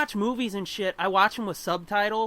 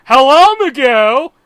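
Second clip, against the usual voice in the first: loud, higher cartoon greeting; redubbed Charlie voice; 5 semitones higher, 15 dB louder, slower than usual. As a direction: chirp a bright dubbed hello. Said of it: "And you just hear".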